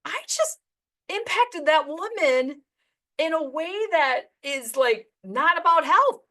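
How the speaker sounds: high, slow, awestruck; amazed realization. Retold or imitated. Agent realizes she changed a client's life, awestruck and slow. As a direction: let the wonder sink in slowly. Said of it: "I was like".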